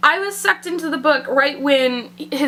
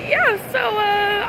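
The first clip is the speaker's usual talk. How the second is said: normal talking voice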